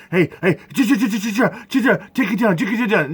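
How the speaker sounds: high, rapid-fire stuttered chills; mock chill-out shusher. Imitating someone telling him to calm down, mocking. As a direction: mimic a pestering calmer-down. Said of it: "sit there to my face and say".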